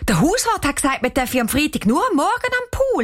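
high, fast grumble rising to a whine; girl complaining. A narrator's reading. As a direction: whine it to Papi, outraged at the end. Girl complains to her father. (Mädchen beschwert sich beim Papi)

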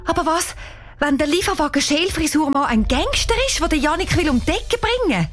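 high-pitched anxious question; panicky. A narrator's reading. Girl imagines a gangster harming friend, panicky. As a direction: spill out the worst fear. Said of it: "Danisa sagt panisch".

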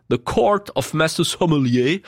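slow, put-on English accent; drawn-out mock English accent on the title; cheap English accent. Shown as a demonstration. Presenter naming the British organisation, cheap English accent. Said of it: "anglais à deux francs cinquante".

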